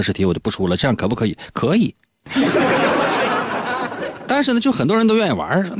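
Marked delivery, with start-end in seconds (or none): 2.3-4.2 high, breathy burst of laughter; laughing. Real, not acted. Host laughing at his own teasing, real laugh. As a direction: laugh at your own joke.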